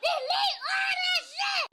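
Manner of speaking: shrieking slow blow-up; mock furious shout. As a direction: push it up even harder on the repeat. Retold telling him off, repeated.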